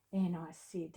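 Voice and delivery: ordinary talk, nothing marked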